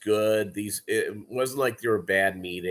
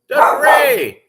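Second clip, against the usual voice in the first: very high, loud shout; 13 dB louder than usual. Real, not acted. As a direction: yell it out. Sudden shout.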